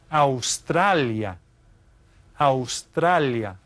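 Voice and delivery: Australia drawn out slowly, ow-STRA-lee-ah in Spanish. Shown as a demonstration. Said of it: "we pronounce it a little bit differently".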